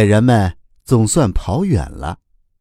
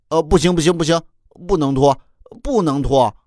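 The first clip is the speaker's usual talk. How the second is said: high, rapid-fire refusals; protesting cry; 6 semitones higher than usual. A narrator's reading. Child refusing to take his clothes off, high protesting cry. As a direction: protest in a raised pitch, repeating urgently. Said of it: "贝贝却喊着".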